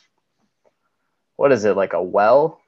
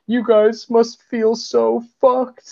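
mocking taunt; 12 semitones higher, slower than usual. Mocking the captured officers.

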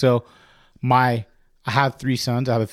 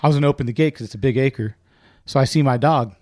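normal talking voice

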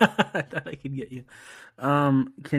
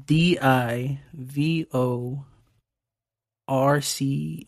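slow letter by letter; spelling it out; much slower than usual. Shown as a demonstration. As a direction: spell each letter out slowly. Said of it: "send you something made up".

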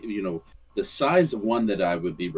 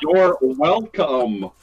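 mock reply; 9 semitones higher, 10 dB louder, much slower than usual. Mock reply after laughter.

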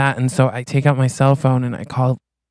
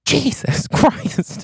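high, slow, laughing "Jesus Christ"; startled gasp that breaks into a laugh; shocked oath through laughter; 12 semitones higher, much slower than usual. Retold or imitated. Cow shot beside her.

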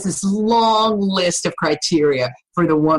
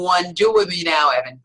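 ordinary delivery

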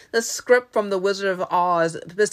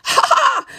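very high, loud triumphant laugh; acted burst of glee; delighted cackle; 7 dB louder than usual. Scarecrow delighted at gaining brain.

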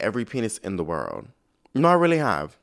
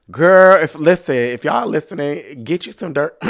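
calling out; 4 semitones higher, 10 dB louder than usual. Real, not acted. Calling out loud to the girls listening to her.

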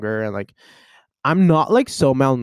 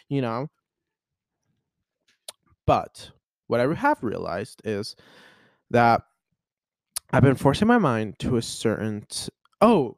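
ordinary talk

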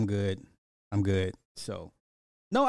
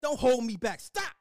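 high, shrill-edged mock protest, pitch jumping higher on stop; imitating her pushing him off; 8 semitones higher than usual. Retelling how she pushed him off, a mock high protest. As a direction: act out her shoving him away and saying don't hold me back. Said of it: "walk me like".

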